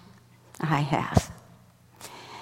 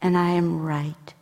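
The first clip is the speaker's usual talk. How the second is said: tender reassurance; 4 dB quieter than usual. Retold or imitated. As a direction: reassure gently, let it sink to a whisper. God reassuring, fading to whisper.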